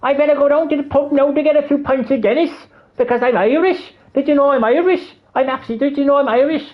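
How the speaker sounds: exaggerated high-pitched stage Irish; mocking over-the-top Irish accent; Jacksepticeye. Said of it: "puts on an Irish accent".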